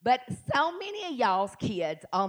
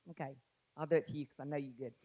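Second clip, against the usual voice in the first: quiet aside; 10 semitones lower, 12 dB quieter, much faster than usual. Real, not acted. Aside to a congregant.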